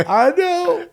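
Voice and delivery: high, loud wail; exaggerated tearful howl; imitated bawling child. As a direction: wail it like a sobbing kid. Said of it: "he just starts bawling".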